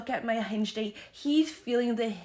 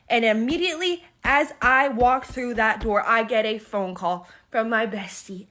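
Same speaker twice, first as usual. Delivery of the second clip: excited build-up; 9 dB louder than usual. Real, not acted. Dramatic story build-up.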